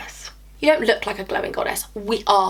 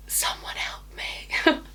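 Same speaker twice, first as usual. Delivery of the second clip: slow, whispered cry for help; whispered mock plea; 10 dB quieter, much slower than usual. Vlogger despairs at getting sidetracked, mock-desperate whisper. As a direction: whisper it as a playful plea, drawn out.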